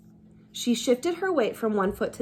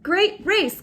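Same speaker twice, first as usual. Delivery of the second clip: coach's praise; 9 semitones higher, 4 dB louder than usual. Acted.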